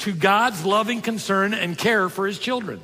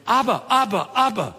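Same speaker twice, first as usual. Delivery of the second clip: put-on higher, eager child calling Abba, Abba; child calling dad; 5 semitones higher than usual. Little kid in Jerusalem runs up calling his dad, eager and higher. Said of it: "a little kid run up to his dad, and he's going".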